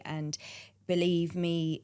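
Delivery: normal talking voice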